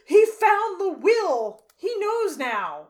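high, loud, slow dramatic line; mock dramatic son. Imitating the son's ominous announcement. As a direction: ham up the movie drama. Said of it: "one of them is like".

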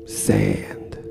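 breathy whispered Sand, falling away. Acted. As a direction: let Sand slip out on a whisper. Repeated word in coma reverie.

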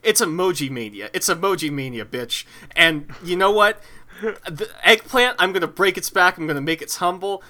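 wrestling promo. Pretend. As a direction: bark it like a wrestler calling out a rival. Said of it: "This is me cutting a promo".

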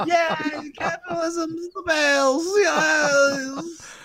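high, shouted sarcastic celebration; mock cheer. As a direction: celebrate it over the top.

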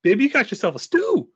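high, hammy penny-pincher voice; hammy Carl Weathers. Retold or imitated. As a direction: ham it up as a thrifty cook. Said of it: "So you start talking about greens".

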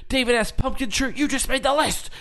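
high, loud mock-indignant taunt; Chris Jericho. Chris Jericho singles out a girl's shirt, mock-indignant shout. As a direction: call it out like a showboating wrestler. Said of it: "It's like".

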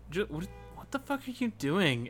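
ordinary talk

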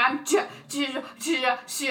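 imitating a nervous stutter on CH. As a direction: get stuck repeating the first sound of Chaz.

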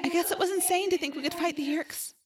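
put-on high, soft, defeated girl; sad. A narrator's reading. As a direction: softly concede that all is lost. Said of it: "Rachel said sadly".

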